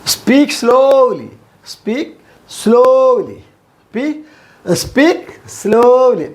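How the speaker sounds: drawn-out word-by-word drill; slow demonstration. Shown as a demonstration. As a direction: stretch out "slowly" with a long vowel. Teacher models phrase very slowly.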